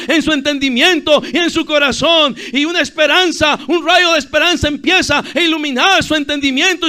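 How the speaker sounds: high, loud pulpit cry; full-force preaching. Real, not acted. Preacher urging youths to call others to Christ, full-force preaching.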